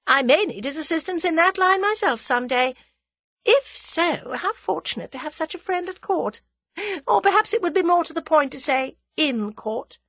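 high, confident lady villain; high-pitched, amused self-assurance; self-assured scheming woman. A narrator's reading. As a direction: play her poised and unworried.

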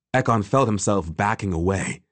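ordinary delivery

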